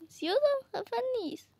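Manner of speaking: usual speaking voice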